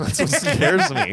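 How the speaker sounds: slow, laughing admission; laughing nervously. Real, not acted. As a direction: laugh through the confession.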